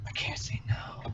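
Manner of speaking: breathy whispered give-in. Retold or imitated. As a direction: whisper it like you're caving in helplessly. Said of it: "I'm gonna be like".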